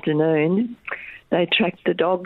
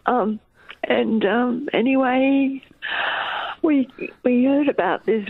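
teary; 7 semitones higher, slower than usual. Real, not acted. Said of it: "Sorry, I'm a bit teary".

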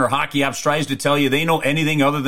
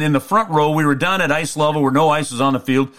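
normal talking voice

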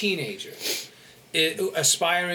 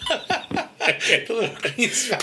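giant voice; 4 semitones higher, much slower than usual. Pretend. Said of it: "if I do the Jolly Green Giant voice".

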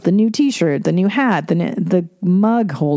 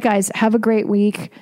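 ordinary talk, nothing marked

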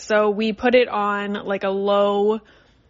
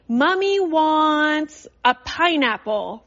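playful; 6 semitones higher, slower than usual. Shown as a demonstration. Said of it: "I just say".

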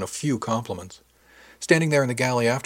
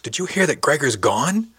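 higher, louder, breaking the news; urgent news; 5 semitones higher, 6 dB louder than usual. Acted. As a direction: burst in with startling news.

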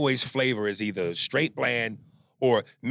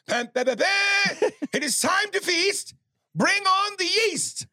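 high, slow booming chant; hammed-up villain proclamation; Dewan Curse voice. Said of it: "some shit from Dewan Curse".

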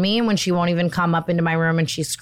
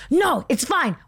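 high-pitched mock dismissal, falling at the end; put-on high insistence; imitated insistent woman; 8 semitones higher, slower than usual. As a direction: mimic a pushy woman waving off objections. Same woman brushing off reassurance, acted.

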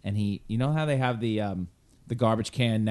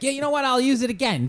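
Pesci voice; 13 semitones higher than usual. Retold or imitated. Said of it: "He's like".

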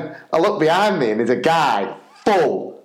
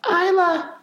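put-on child voice. Daughter voiced in story.

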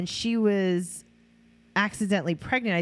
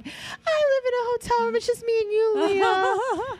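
much higher, put-on Amber voice; mocking Amber. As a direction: ham up Amber's lovesick purr to Leah. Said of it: "and she was like".

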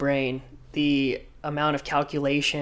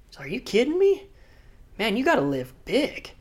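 higher, mock-incredulous push; excited urging; 8 semitones higher than usual. Island founder's brother urging him to surf, excited. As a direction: egg him on in disbelief.

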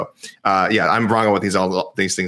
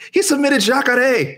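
high, drawn-out cry of disbelief; incredulous protest; 3 dB louder, slower than usual. Real, not acted. Cohost protesting a missed bonus, incredulous. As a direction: protest it slowly, pitched way up.

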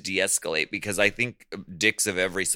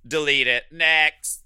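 mock dismissive; 7 semitones higher, 6 dB louder than usual.

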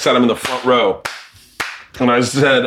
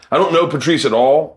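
usual speaking voice

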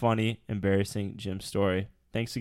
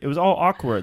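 loud emphatic repeat; 5 semitones higher, 5 dB louder than usual. Real, not acted.

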